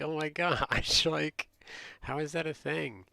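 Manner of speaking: loud, high incredulity with laughter; amused disbelief. Retold or imitated. Reacting to Floridians spotting the accent, amused disbelief.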